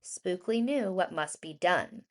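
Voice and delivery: ordinary delivery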